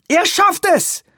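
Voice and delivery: sehr hoch geschrien. Acted. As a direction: schrei es hoch hinaus. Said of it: "schrie Weaver". (hoher Schrei Weavers)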